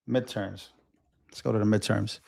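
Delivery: very soft, low self-talk; absent-minded aside. Real, not acted. Streamer looking for the midterms page, quiet distracted murmur. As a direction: murmur it to yourself while searching. Talking to himself while searching.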